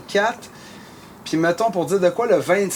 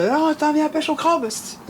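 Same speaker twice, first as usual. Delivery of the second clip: insistent buddy; 9 semitones higher than usual. Retold or imitated. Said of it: "Puis là, je dis".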